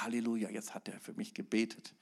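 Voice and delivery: breathy whispered relief. Retold or imitated. Believer whispering after being prayed for. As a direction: whisper it like a secret hope. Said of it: "Du sagst".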